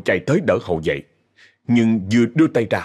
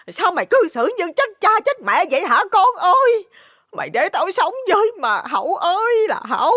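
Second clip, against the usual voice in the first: very high, wailing lament; tearful, high-pitched scolding; crying and shouting. Acted. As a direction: wail despairingly at your son. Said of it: "vừa khóc vừa la".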